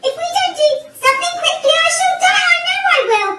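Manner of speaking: high, loud frantic outburst; screamed. A narrator's reading. Mister Twit certain he will die, screamed. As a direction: rush in, then wail in panic. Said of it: "screamed Mister Twit".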